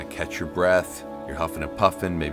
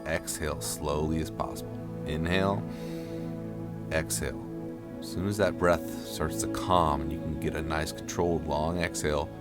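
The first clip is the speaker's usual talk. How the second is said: guided breathing; 3 dB quieter than usual. Acted. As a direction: ease them through each breath. Guides breaths in ice bath.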